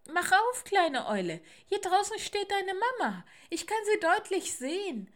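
high, loud squirrel call. Acted. Squirrel urges little owl to open up, loud high calling. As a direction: call through a closed door. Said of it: "an die Tür und rief".

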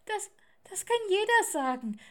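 put-on high, loud childish protest; doubting little owl. Little owl distrusts the voice outside, high and childish. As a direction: stammer the start, then pipe up.

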